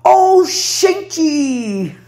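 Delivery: high, sung-out Bahian "Ô, gente" with falling tune. Shown as a demonstration. Woman showing her own Bahian greeting, sung out high. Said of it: "Olha que eu falo assim".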